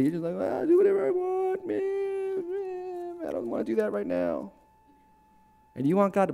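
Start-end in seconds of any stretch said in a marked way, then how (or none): 0.3-3.3 high, drawn-out careless whine; mock slacker singsong, trailing softer; flippant. Believer brushing off God's contract, flippant mockery. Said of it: "you just take it flippantly". 3.3-4.5 low, soft, offhand mock reluctance; flippant. Believer putting God off, flippant mockery. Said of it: "you just take it flippantly".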